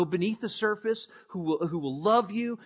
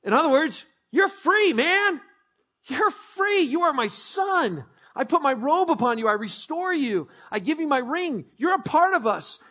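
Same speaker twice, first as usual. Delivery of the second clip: much higher and louder, jubilant; preaching climax; 8 semitones higher, 5 dB louder than usual. Real, not acted. Pastor proclaiming freedom to the congregation, jubilant. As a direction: shout the good news.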